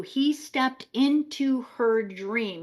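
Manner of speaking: slow, deliberate; slow emphatic. Shown as a demonstration. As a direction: lay each word down slowly. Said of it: "I want you guys to catch this".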